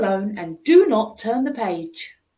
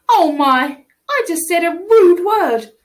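shocked gasp; 3 semitones higher, 6 dB louder than usual. Acted. Child shocked at own rudeness.

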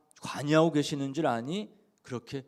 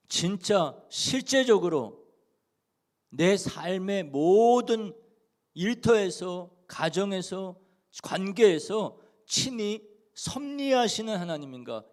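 slow, louder, rising appeal to the congregation; fervent preaching; 5 semitones higher, 5 dB louder, slower than usual. Acted. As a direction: press each phrase home slowly. Fervent slow climax of sermon. (천천히 힘주어 외치는 설교)

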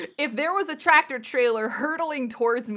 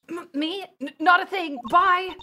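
nervous stammer; 6 semitones higher, 4 dB louder than usual. Acted. Voicing nervous Apollo.

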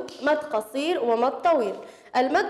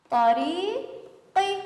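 طريقي stretched very slowly, higher; slow spelling-out; 5 semitones higher, much slower than usual. Shown as a demonstration. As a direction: sound out each letter of طريقي slowly. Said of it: "نلفظ كل حرف حسب صوته". (تهجئة بطيئة جدًا لكلمة طريقي)

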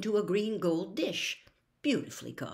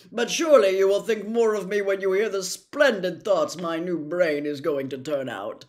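Scarecrow voice; 8 dB louder than usual. Acted. Scarecrow telling his friends he'll get brains, loud and eager. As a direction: crow it out like a jubilant scarecrow.

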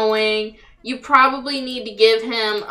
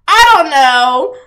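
"I don't know" stretched slow and louder, ending on a rise; drawn-out unsure; 6 dB louder, slower than usual. Shown as a demonstration. Baffled at the Sims, slowly drawing out "I don't know". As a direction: drag out "I don't know" like a shrug.

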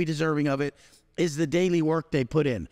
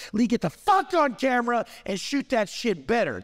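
retold yelling coach, pitched higher; mock coach yell; 6 semitones higher than usual. Coach retelling his own orders to Lee, a mild mock yell. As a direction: bark it like a harsh coach. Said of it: "Wes Watson says".

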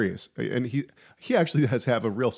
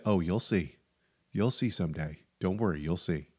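low, soft imitation of a friend's warning; quiet knowing warning; 5 semitones lower, 6 dB quieter than usual.

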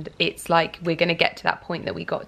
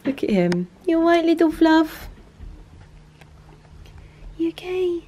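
cooing pet talk; 11 semitones higher, 3 dB louder than usual. Real, not acted. Cooing to the cat.

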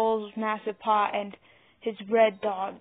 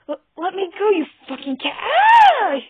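stammered high, loud plea breaking into a scream; panicked and furious, ending in a shriek; pained struggle; 10 semitones higher, 13 dB louder than usual. A narrator's reading. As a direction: struggle to get free, end in a yell. Dog squeezed, struggling to escape.

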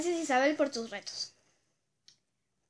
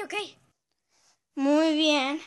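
usual speaking voice

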